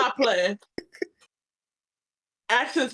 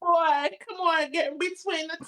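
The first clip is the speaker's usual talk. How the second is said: playful demand; 7 semitones higher than usual. Pretend. Demanding foot rub.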